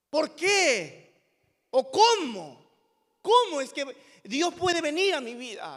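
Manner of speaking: loud, high cries of disbelief; anguished questioning. Acted. Preacher voices believer questioning God, anguished cry. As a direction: cry out each question to heaven. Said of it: "¿Te lo has preguntado?".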